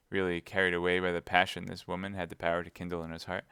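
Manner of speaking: plain reading voice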